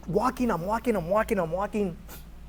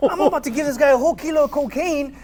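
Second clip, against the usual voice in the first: excited disbelief; 7 semitones higher, 10 dB louder than usual. Real, not acted. Stunned retelling of the deal.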